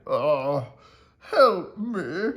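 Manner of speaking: put-on the old mouse wails as he runs home; crying for help. A narrator's reading. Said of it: "ran home and cried".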